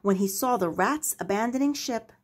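ordinary delivery

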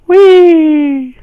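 high, loud playful squeal; enjoy the slide out loud; gleeful whee. Real, not acted. Going down the slide.

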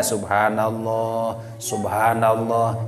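low, level "subhanallah" with long syllables; drawn-out, low-pitched "subhanallah"; dhikr intonation. Shown as a demonstration. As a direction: repeat "subhanallah" slowly and softly as dhikr. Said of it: "Jadi urang zikir".

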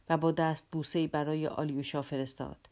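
ordinary delivery, nothing marked

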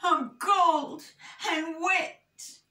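high, miserable whinge; hammed-up sorry little bear; moaning. A narrator's reading. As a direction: moan it way up high. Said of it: "he moaned".

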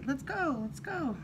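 usual speaking voice

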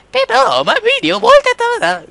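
high squeaky robot imitation; mock a glitchy robot voice. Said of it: "Now you're roboting".

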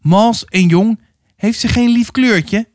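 high-pitched calling to a mate; shouted jeer. A narrator's reading. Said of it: "schreeuwde hij". (hoog uitgeroepen, jouwend)

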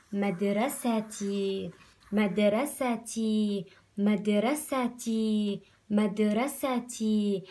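stretched slowly, syllable by syllable; slow syllable reading. Shown as a demonstration. Teacher reading a word slowly to her class. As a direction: read it out slowly for first graders. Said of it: "تي شو صارت؟".

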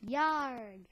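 pirate growl; a little pirate yarg. Pretend. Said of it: "I sounded like a pirate".

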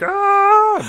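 high loud whee down an ice slide; sliding yell. Pretend. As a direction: shout it like you're flying down a slide. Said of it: "where it's like".

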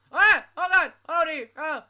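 high, loud scared cries; terrified gibbering. Real, not acted. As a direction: shriek in real fright. Startled scream at monster.

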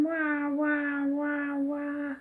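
drawn-out, spoken wah-wah sobs; mock crying. Grumpy crying over Esme, mock wailing.

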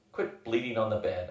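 usual speaking voice

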